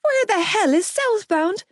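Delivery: high, panicked outburst. A narrator's reading. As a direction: freak out at the directions. Gibbsy panicked at sat-nav.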